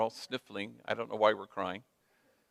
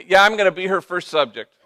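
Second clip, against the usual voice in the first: higher and louder, laughing through it; laughing joke; 6 semitones higher, 8 dB louder than usual. Retold or imitated. Father chiming in about his daughter, laughing. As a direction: chuckle as you tease.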